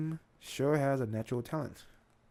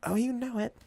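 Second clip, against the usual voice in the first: high, louder squirrel voice; Tippy Toe; 9 semitones higher, 5 dB louder than usual. Acted. Squirrel sidekick answering "Ready?", high character voice. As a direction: pipe up eagerly as the sidekick. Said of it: "Tippy Toe goes".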